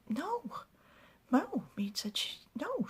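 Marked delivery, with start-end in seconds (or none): 0.1-1.6 high-pitched, disbelieving no; acted shocked denial. Mead answers whether Doric was ill, acted shocked denial. 2.2-2.9 high-pitched, disbelieving no; acted shocked denial. Mead answers whether Doric was ill, acted shocked denial.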